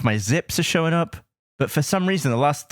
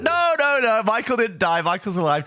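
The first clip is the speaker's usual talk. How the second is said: imitated alarmed correction, easing down at the end; urgent reassurance; 8 semitones higher than usual. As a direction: hurry to undo the misunderstanding, voice pitched up. Urgently correcting a horrified fan.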